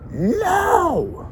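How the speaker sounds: put-on very high squealed child's no; shrieking kid tantrum. Kid freaking out on bench.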